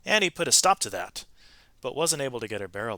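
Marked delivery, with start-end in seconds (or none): none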